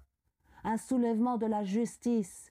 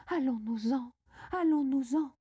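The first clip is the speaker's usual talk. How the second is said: lightly voiced frantic mother, second plea higher; distraught repeated plea; 4 semitones higher than usual. A narrator's reading. Frantic mother begs her husband to leave, distraught plea. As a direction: urge them to leave, mildly panicked. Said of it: "Ma mère éperdue répétait coup sur coup".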